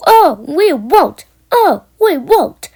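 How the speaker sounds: high, loud, slow defiant scream; screaming trucks. A narrator's reading. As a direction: shriek it back stubbornly. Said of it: "screamed the trucks".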